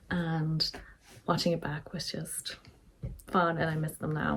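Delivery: ordinary delivery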